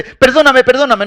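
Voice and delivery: pleading for forgiveness. Retold or imitated. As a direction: act out a spouse begging pardon mid-fight. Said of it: "provocó los gritos dice".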